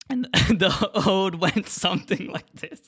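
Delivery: giggling while speaking; laughing. Real, not acted. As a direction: laugh breaking through. Breaks up laughing mid line.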